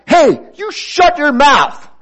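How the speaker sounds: loud, high, drawn-out mock scolding; mock angry retort. Acting out getting back at someone who wrongs us, mock angry shout. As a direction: snap back loudly in pretend outrage.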